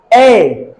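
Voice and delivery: ए drawn out a little; teacher holding ए long; stretched long vowel. Shown as a demonstration. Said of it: "अब ये थोड़ा सा खिंचाएगा".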